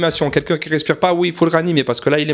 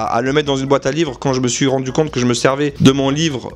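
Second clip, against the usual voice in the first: ordinary delivery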